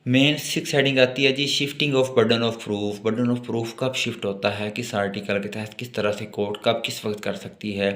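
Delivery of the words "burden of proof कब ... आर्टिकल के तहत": ordinary talk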